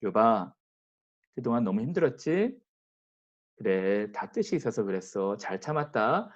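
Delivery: soft, tender voice of a consoling God; a father God soothing a suffering man; gentle comfort. Retold or imitated. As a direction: comfort him softly like a gentle breeze. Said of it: "잔잔한 그 바람으로 오셔서 위로하시".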